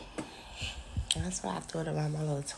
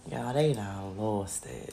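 low, slow deadpan; deadpan verdict; 7 semitones lower, slower than usual. Real, not acted. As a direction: drag it out flatly.

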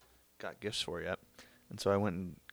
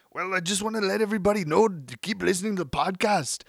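put-on higher, louder slipping Austrian accent; drifting Arnold accent; 10 semitones higher, 6 dB louder than usual. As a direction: let the Schwarzenegger voice wander. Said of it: "this accent is changing".

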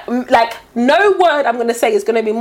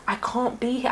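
very soft, under-the-breath confession; hushed aside; 11 dB quieter than usual. Retold or imitated. Retelling a private plea in the office, hushed.

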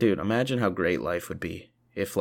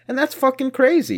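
higher and louder, amazed; excited shout; 7 dB louder than usual. Real, not acted. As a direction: burst out in disbelief. Excited outburst.

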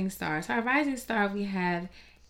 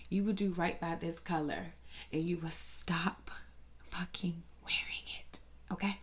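hushed, earnest whisper; sarcastic whispered plea to trend followers; mock solemn whisper; 5 dB quieter than usual. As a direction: whisper it like a grave warning. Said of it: "pleading with neon wearers".

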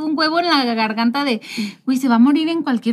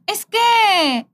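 little daughter's voice; 9 semitones higher than usual. Retold or imitated. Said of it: "Lore luego llega y así de".

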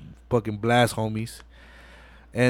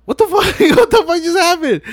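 very high, loud comic disbelief; mock shock; 6 dB louder, faster than usual. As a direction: squeal it like a stunned movie character. Voicing Brian shocked by the shooting.

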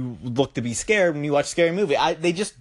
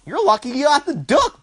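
normal talking voice

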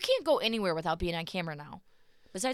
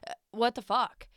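angry demand; 5 semitones higher, 5 dB louder than usual. Retold or imitated. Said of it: "she's like".

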